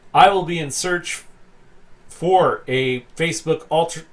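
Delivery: ordinary delivery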